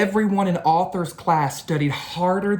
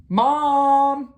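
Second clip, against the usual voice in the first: much higher, louder call; 10 semitones higher, 5 dB louder than usual. Acted. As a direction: call for Mom from the next room. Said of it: "called Arthur".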